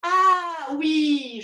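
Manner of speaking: usual speaking voice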